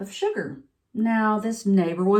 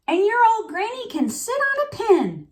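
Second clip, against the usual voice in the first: very high, rude cartoon pig; squeaky pig voice; 12 semitones higher than usual. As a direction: taunt it like a squealing piglet. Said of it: "the third little pig yelled out".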